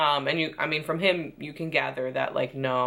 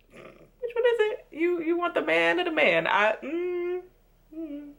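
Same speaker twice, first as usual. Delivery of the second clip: much higher, sing-song exasperated question; mock falsetto disbelief; 12 semitones higher than usual. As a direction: squeak it out in comic disbelief. Exasperated mock-high challenge.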